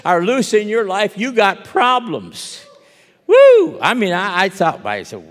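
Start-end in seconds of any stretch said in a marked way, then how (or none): 3.3-3.8 sudden loud high woo; preacher whooping in alarm. Real, not acted. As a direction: let out a big whoop. Exclaiming at the danger.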